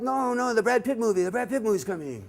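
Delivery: high-pitched, hammed-up moviegoer; overexcited fan, played for laughs; mock excitement. Mock thrill at the next movie.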